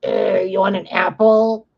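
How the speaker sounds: slow, high crone's voice; old witch. Retold or imitated. Disney witch offering an apple, slow high crone voice. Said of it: "the villain as an old lady".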